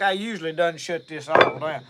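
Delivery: ordinary talk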